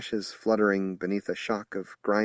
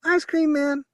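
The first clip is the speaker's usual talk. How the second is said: put-on high child voice, drawn-out Southern drawl; child's drawl; 6 dB louder than usual. A narrator's reading. Little Ava announcing the truck, childish drawl. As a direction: drawl it like a little country girl. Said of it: "drawled Ava".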